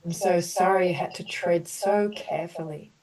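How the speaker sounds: gentle, measured apology statements for the group; compassionate healing affirmations. Acted. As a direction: offer each sorry like a soothing balm. Leads group through healing apologies.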